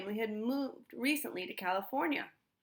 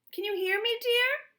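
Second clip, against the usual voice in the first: high, louder calling out; calling into the box; 13 semitones higher, 5 dB louder than usual. A narrator's reading. Mother calling to Stanley in the mailbox, high and loud. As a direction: call through the metal box.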